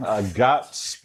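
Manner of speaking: normal talking voice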